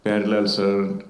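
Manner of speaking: normal talking voice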